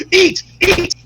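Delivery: high, urgent imitated orders; mock commanding. Handlers instruct wrestler entering ring, mock commanding. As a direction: urge on a savage fighter.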